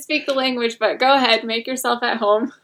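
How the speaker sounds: lightly voiced, breezy welcome; mock hosts. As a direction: daughter teasing how casually her parents took in strangers. Mock quote of the generous parents.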